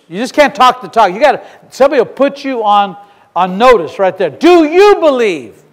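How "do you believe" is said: high, drawn-out YOU BELIEVE; insistent challenge. Retold or imitated. Somebody puts you on notice, insistent challenge. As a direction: press each word slowly, pitched up on YOU.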